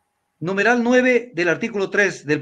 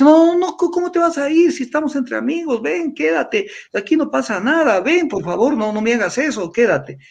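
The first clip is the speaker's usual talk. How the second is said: insistent friend; 7 semitones higher than usual. Retold or imitated. Friend urging him to stay, high insistent coaxing. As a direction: beg him to stay, pitched high at first. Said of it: "Y entonces me dice".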